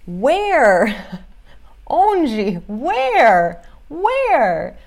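loud, stretched "where?" with swooping pitch; expressive questioning. Shown as a demonstration. Teacher demonstrating "where" physically for students, exaggerated asking. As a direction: act out searching questions, big and exaggerated. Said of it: "expressar, isso é uma coisa física".

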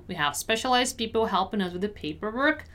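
brisk, linked-up read. Shown as a demonstration. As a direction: say it at conversational speed. Teacher repeats at quicker pace.

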